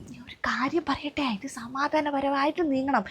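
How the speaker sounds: soft, hushed advice; conspiratorial whisper. Acted. Friend calming the mother, whispering. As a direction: lean in and keep it secret. Secret advice to keep quiet.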